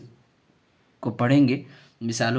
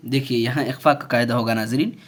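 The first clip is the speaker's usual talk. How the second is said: ordinary talk, nothing marked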